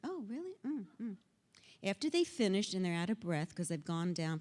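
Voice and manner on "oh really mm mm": soft, lilting, patient prompting; feigned interest. Acted. Childfree person drawing out the arguer, softly feigned interest. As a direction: murmur it softly, humouring them. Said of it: "Keep asking them to tell you more".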